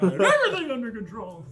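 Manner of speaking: mocking the cracking line; high cracking voice mimic. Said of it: "The voice crack".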